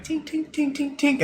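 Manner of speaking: high, soft tink tink tink like steel tapping; metallic tinking. Retold or imitated. GM voicing the dwarf's gauntlet tapping, light high tinks. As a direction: mimic a gauntlet clicking on armor.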